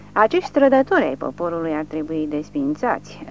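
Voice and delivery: usual speaking voice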